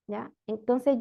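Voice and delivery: ordinary talk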